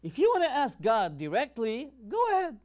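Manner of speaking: mocking high quote. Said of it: "He says".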